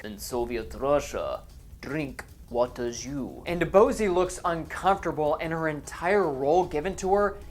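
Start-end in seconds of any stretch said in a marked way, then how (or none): 0.0-3.4 deep, slow mock Russian accent; hammy fake Russian accent. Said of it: "in a faux Russian accent".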